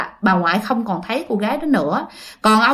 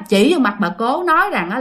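normal talking voice

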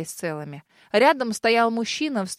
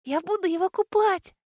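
put-on tender little girl babble; 9 semitones higher than usual. A narrator's reading. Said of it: "нежно лепетала малышка".

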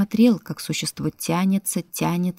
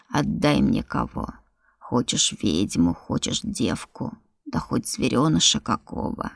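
creature voice; 5 semitones lower than usual. Acted. Creature bargains, low slow.

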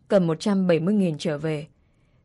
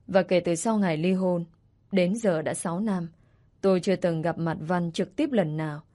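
plain reading voice

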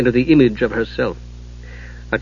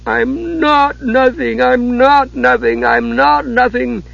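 slow high sobbing kender; 11 semitones higher, slower than usual. Acted. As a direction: sob the words over and over. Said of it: "was sobbing over and over".

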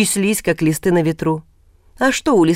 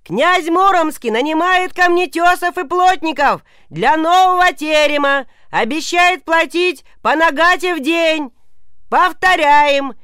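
much higher, slow bellowed announcement; town crier shout; 12 semitones higher, slower than usual. Acted. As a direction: call it across a crowded market. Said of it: "как орут беречи".

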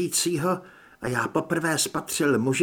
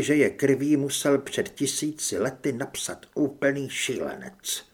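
ordinary delivery, nothing marked

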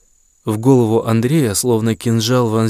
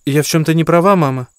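put-on young woman voice; 6 semitones higher than usual. Daughter retorts.

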